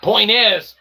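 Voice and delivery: usual speaking voice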